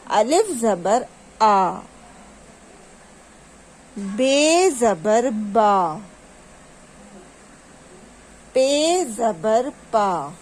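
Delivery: slow, measured letter drill with long final vowels; drawn-out reading drill. Shown as a demonstration. Teacher showing children how to read, drawn out. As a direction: stretch each letter name and syllable. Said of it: "جس طرح سے میں پڑھ رہی ہوں".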